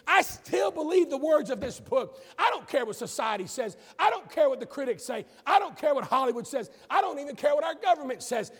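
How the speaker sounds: preacher rallies the church, loud and fervent; full-force preaching. Real, not acted.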